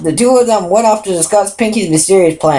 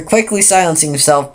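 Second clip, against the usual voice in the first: usual speaking voice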